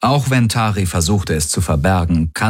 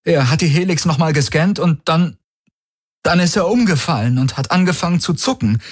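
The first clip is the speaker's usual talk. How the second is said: high, agitated account with a halting break; feigned distress; 11 semitones higher than usual. A narrator's reading. Valyr tells Tari how Jun collapsed, in feigned distress. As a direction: play the shocked friend, voice well up.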